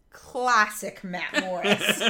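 slow, laughing delivery. Real, not acted. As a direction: say it while laughing. Laughing at picture.

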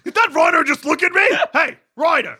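mock shout at rhino. Dusha at the zoo spotting the rhino, mock shout.

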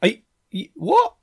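real surprise at the news, rising sharply; shocked disbelief. Real, not acted. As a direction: voice leaps way up on what. Guest reveals he's English.

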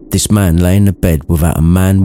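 ordinary delivery, nothing marked

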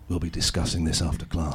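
low, restrained teacher voice; strict teacher. Retold or imitated. Strict teacher to pupil over swear words, low and controlled. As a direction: say it low, as an ominous warning. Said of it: "He was a strict teacher".

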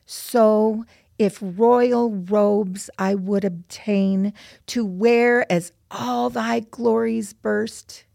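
measured, deliberate verse with pauses between phrases; poem recitation. Acted. Daughter reciting mother's poem to friends, measured recitation. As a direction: recite it as a heartfelt prayer.

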